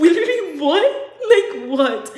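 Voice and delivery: high, loud, laughing disbelief; retold flabbergasted reaction; incredulous. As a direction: burst out in mock disbelief. Retells her incredulous reaction.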